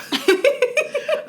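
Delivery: squealing burst of laughter; high laugh. Real, not acted. Laughing at the friend's worry.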